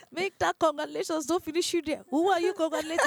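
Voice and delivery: high, loud disbelief, quick at the end; incredulous retort. Retold or imitated. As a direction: push back in shocked disbelief. Retold incredulous reply to doctor.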